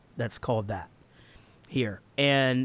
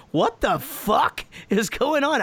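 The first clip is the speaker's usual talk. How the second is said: 1 baffled; 11 semitones higher, 4 dB louder, slower than usual. Retold or imitated. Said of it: "you guys have to be just like".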